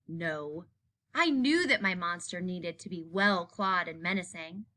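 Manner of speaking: usual speaking voice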